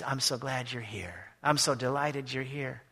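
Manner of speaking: slow, soft, gentle welcome; tender Jesus. Acted. Jesus greeting each believer, slow, soft and tender. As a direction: savor each word of the welcome. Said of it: "And he's".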